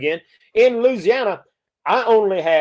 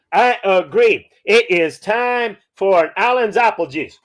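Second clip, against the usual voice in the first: usual speaking voice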